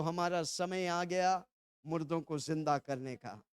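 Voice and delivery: soft, low, measured declaration; feed the line softly for repeating; congregational prompt. Shown as a demonstration. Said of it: "जोर से बोलो".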